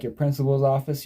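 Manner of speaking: ordinary talk, nothing marked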